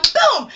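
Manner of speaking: loud, high boom; explosive vocal sound effect; imitated impact. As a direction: punch out the jump scare.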